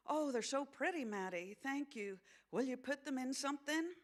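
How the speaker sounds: put-on high American-accented character voice; young mother character. Young mother thanking friend for flowers, high grateful voice.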